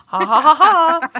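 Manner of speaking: high, loud "ha ha ha"; performed laugh. Pretend. Reading Liz's message, loud high put-on laugh.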